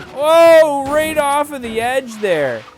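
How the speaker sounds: loud, high drawn-out whoa; startled exclamation. Real, not acted. Driver reacting to going off the edge, real startled shout. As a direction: cry out as the car slips away. Car slides off edge while drifting.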